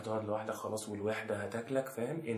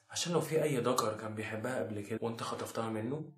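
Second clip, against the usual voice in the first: normal talking voice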